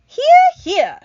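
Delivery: very high, loud cheer. Acted. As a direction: cheer him on. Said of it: "said the ladybug".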